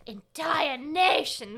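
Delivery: horror trailer voice. Acted. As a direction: boom it out like a movie trailer. Dramatic ending of card reading.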